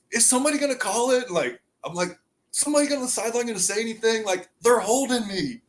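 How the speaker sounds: high-pitched quoted whining; lifted, protesting mock gripe; retold indignant complaint. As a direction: plead for a call, acting out the memory. Retold complaint during film session.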